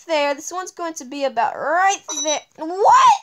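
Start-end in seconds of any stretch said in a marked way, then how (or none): 2.6-3.1 very high, incredulous squawk; shocked disbelief. Real, not acted. Player baffled by a sudden loss, shocked high-pitched outburst.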